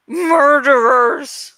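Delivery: slow, bitter hiss of the word. Acted. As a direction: spit out "Murderers!" slowly. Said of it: "hissed Saphira".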